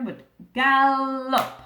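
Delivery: "gallop" said slowly and loudly; emphatic. Shown as a demonstration. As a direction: lean hard on "gallop", drawn out. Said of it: "More V C C V words".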